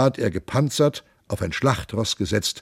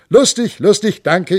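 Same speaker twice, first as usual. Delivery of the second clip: merry cheer; 10 semitones higher, 7 dB louder than usual. Acted. Monk cheers at table.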